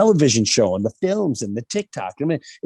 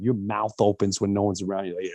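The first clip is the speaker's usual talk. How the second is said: usual speaking voice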